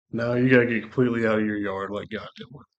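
ordinary talk

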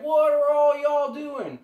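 high, loud, drawn-out holler; loud demand. Acted. Six-foot rabbit accosts the monsters, slow loud holler. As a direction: holler the question slowly at everyone.

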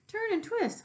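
very high honked order; a goose honking directions. Acted. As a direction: honk it out. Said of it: "honked the goose".

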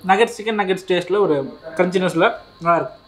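talking between bites of chicken; speaking while biting food; mouth full while chewing. Real, not acted. Seen 0: Fork with chicken at lips, bites it at 1.1s.

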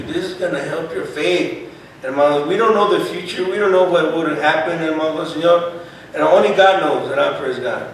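ordinary talk

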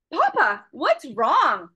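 plain reading voice

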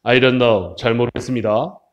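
low dialogue reply; low-voiced answering character. B answering that they don't know, low voice. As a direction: drop the pitch for the second character. 그랬더니.

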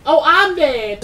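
high, loud cry of defeat; panicked yell. Real, not acted. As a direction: shout it as the game gets you. Panic at dying in game.

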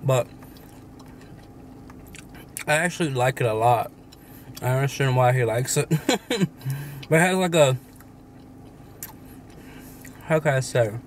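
holding half-eaten candy; possibly finishing chewing; talking between bites. Real, not acted. Seen 0: Holds bitten candy piece while talking; bite taken seconds earlier.

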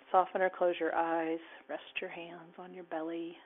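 calm guided relaxation cue; guided meditation. Acted. Guides relaxation and loving kindness.

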